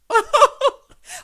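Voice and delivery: high-pitched whooping cackle; loud high laugh. Real, not acted. Vlogger reacting to the gossip, loud high cackle. Laughing at the quoted decision to tell.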